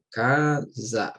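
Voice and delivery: "casa" split slowly into ca, za; sílaba por sílaba. Shown as a demonstration. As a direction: say "casa" in two slow, separate syllables. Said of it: "como a palavra casa". ("casa" devagar, separando ca e za)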